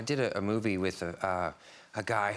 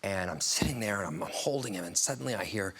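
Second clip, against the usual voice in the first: usual speaking voice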